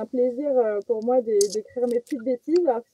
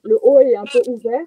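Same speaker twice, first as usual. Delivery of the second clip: usual speaking voice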